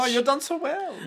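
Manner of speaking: high, cooing pet-owner voice; put-on doting squeal; gushing praise. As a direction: coo it high, like to a baby animal. Voicing Gwess praising her pet.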